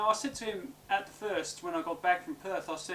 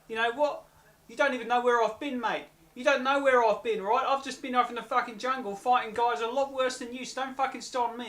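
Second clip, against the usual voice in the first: higher-pitched retold telling-off; heated confrontation; 5 semitones higher than usual. Man retells confronting whoever barked at him, heated telling-off. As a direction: square up to an opponent, indignant.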